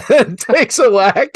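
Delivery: loud, high, slowed laughing through words. Real, not acted. Golfer telling of the dad's flask, loud laughing delivery. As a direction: laugh as you say it.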